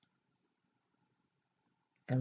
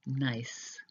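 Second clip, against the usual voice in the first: normal talking voice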